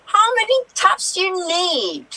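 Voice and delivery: exasperated. Acted. Landlord argument, exasperated.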